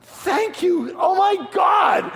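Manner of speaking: high, slow, overjoyed shout; squealing with joy at the deal; ecstatic shout. Retold or imitated. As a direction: scream your thanks, thrilled. Ecstatic at buying the car.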